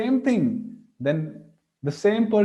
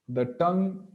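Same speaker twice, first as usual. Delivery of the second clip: ordinary talk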